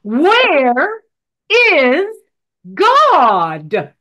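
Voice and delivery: booming stern clergyman. Acted. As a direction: press the question again, heavier. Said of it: "Clergyman said again".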